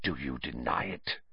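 very soft, low challenge; hushed interrogation; quiet accusation. A narrator's reading. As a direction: drop low and hushed, menacing.

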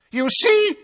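very high, loud triumphant roar. A narrator's reading. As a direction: crow it out. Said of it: "roared Fudge".

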